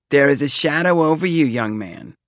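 ominous Romany seer, high and loud; thick Romany accent. A narrator's reading. Fortune-teller warns young customer of a shadow, thick Romany accent. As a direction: proclaim it in a stagy Romany accent. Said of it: "talked in a thick Romany accent".

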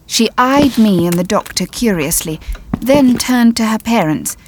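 plain reading voice

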